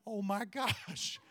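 put-on a father floored by his little girl's compliment, laughing in surprise; stunned laughing gasp. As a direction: let the surprise catch you mid-laugh.

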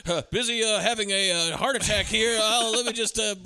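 lightly voiced panic attack from behind a door; mock panicked Costner.

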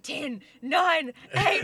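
mock countdown. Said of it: "our heads were like".

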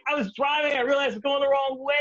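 mock-flustered driver; flustered explaining. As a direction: scramble to explain to the cop. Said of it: "I was like".

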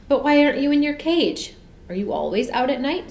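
put-on high eager boy's question; excited child. A narrator's reading. Michael asks the bear.

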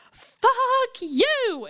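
loud, high parting shout; yelling. Retold or imitated. Shouting at the boyfriend as he drives off, full yell. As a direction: scream it after the car.

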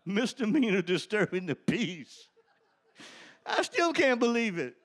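loud, laughing, still amazed; laughing disbelief. Real, not acted. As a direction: laugh through the words in wonder.

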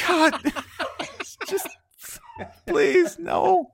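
very high, strained pleading; hammed-up squeaky despair; mock-desperate dad. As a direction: beg helplessly for it to stop. Dad begging for it to end.

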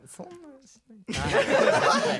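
high, drawn-out laughing denial; sulky protest. Real, not acted. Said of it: "めっちゃすねてるやん".

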